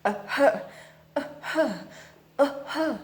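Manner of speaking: short breathy panting puffs; panting aeroplane. A narrator's reading. Aeroplane panting for breath as it flies. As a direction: puff like a tired engine. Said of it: "it went".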